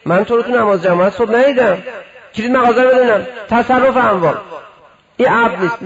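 loud put-on zealot demanding the shop key; mock zealot scolding. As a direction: bark at him like a self-righteous inspector.

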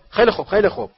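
loud high shout cutting him off. A narrator's reading. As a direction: bark it to shut Harry up. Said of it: "عمو ورنن فریاد زد".

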